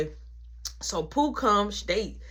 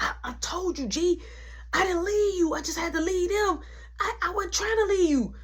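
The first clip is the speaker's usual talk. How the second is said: higher pleading voice; pleading girlfriend; 9 semitones higher than usual. Retold or imitated. Jamie insisting she never left him, high pleading. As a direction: plead your innocence up high. Voicing Jamie's defensive excuse.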